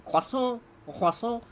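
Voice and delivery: "croissant" said high and pushy, like a demand; mock-demanding French "croissant". Shown as a demonstration. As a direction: order the "croissant" like you're demanding it. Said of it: "You don't need to say it croissant like you're demanding".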